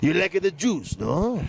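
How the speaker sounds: Italian mob boss. Pretend. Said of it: "What would an Italian, uh, mob boss say?".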